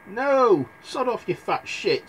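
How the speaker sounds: high defiant retort; lightly voiced cheeky boy; cheeky Jack. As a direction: shout it back at the giant. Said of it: "said Jack".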